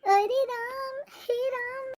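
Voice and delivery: high, theatrical lament; dramatic wail. Acted. Mother-in-law wails over the food.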